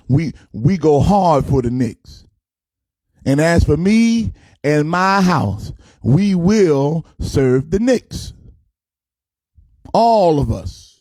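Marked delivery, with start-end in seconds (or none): 3.2-10.9 slow, preachy scripture cadence; mock sermon. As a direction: intone it like a pulpit verse.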